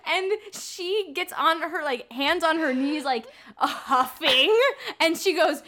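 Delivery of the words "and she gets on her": ordinary delivery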